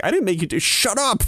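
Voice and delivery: high snapping wife voice; hammed-up high wife telling him off; mock wife. Wife snapping back at husband, mock high voice. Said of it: "she's like".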